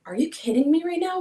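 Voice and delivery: high, shocked disbelief; can't believe what he's doing; retold protest on the lawn; incredulous.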